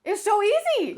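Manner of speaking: put-on high, pleased child voice; lightly voiced proud toddler; proud little kid. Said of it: "I'm like".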